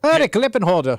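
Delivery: put-on hammy accent meant as Swedish, lands Irish; Irish-sounding accent. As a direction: push a bad Irish lilt with a laugh. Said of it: "That's Irish".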